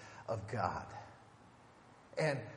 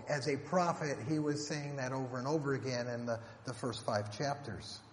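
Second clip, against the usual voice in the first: ordinary delivery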